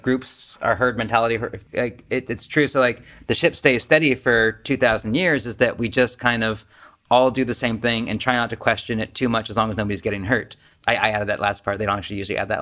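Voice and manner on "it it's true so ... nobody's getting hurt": normal talking voice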